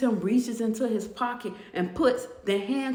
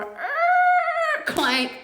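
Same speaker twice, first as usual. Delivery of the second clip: high loud screech, then hard clank; vocal sound effect of creaking metal; imitated door noise; 6 dB louder than usual. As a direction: mimic a cell door squealing shut. Imitates cell door closing.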